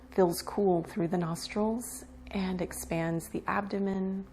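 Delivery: unhurried meditation guide with long pauses; guided meditation. Acted. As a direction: ease them into relaxation, leaving space between phrases. Leads breath awareness meditation.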